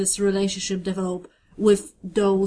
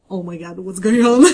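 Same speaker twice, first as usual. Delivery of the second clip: loud, hammed-up male panic; mock freak-out; 8 dB louder, faster than usual. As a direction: burst out in pretend alarm. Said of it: "very guy, like, freak out".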